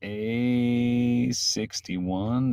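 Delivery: first sound held very long; absent-minded, long-held vowel, softly spoken; drawn-out stalling. Real, not acted. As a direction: stretch it out slowly while searching. Stalling while searching for a clip.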